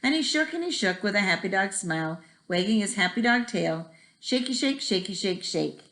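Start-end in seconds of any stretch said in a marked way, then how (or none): none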